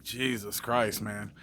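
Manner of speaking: low, slow, under-the-breath groan at a pest; soft, drawn-out sigh of annoyance; exasperated mutter. Retold or imitated. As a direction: mutter it slow like you're fed up. Mechanic exasperated by constant questions.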